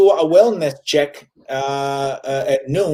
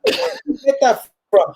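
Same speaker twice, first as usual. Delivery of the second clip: incredulous son; 9 semitones higher than usual. Acted. Son questions mother's borrowed accent.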